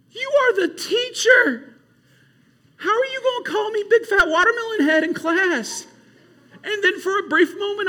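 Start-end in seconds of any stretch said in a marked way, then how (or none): 0.1-5.9 put-on a kid talking back to the teacher, high and stunned; indignant kid retort. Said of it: "I was just like".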